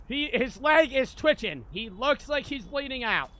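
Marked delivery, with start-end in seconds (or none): none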